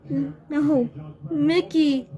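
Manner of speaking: high, shaky voice of shock and grief; distraught cry. Acted. Rabbit crying out over his eaten friend, distraught. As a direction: cry out as your friend is devoured. Oswald sees Mickey eaten.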